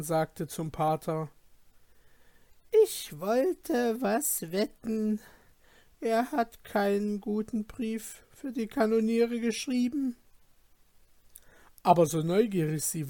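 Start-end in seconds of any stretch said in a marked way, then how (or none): 2.8-10.2 put-on old woman voice. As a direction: gossip like a nosy old nurse.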